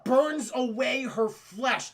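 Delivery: ordinary delivery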